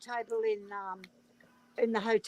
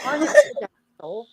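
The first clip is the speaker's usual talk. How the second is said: loud protest; 6 semitones higher, 11 dB louder, faster than usual. Real, not acted. Mum protests loudly.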